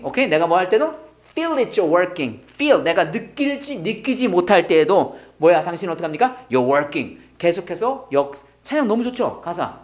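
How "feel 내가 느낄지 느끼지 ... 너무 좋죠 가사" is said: usual speaking voice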